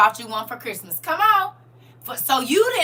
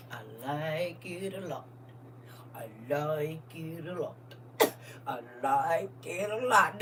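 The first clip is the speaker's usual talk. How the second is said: savoring; 8 semitones lower, 11 dB quieter than usual. Real, not acted. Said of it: "repeated savoring".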